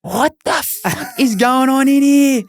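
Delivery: mock her horrified shriek; the wife walks in on the naked jockey, shrieking; wife bursting in. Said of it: "she's going".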